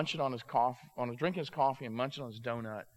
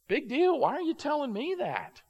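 high, loud dismissive comeback; dismissive retort; 11 semitones higher, 6 dB louder than usual. Retold or imitated. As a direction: brush her off, pitched way up. Friend snaps back at birthday news.

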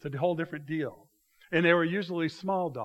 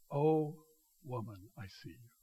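tender address; 4 semitones lower, 5 dB quieter than usual. Acted. Jesus speaking tenderly to woman.

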